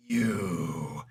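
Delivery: very low, drawn-out menacing voice; Johnny Cage. Retold or imitated. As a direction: drop deep and stretch the word. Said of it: "he's got that, that Johnny Cage".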